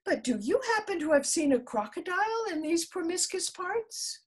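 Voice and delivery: high, polite little voice; polite elephant's child. A narrator's reading. The elephant's child asks the snake, high and polite. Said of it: "most politely".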